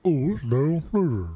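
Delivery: lightly voiced imitation of Phil; Phil impression. Mocking a chat friend with a light Phil voice. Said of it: "That's my Phil impression".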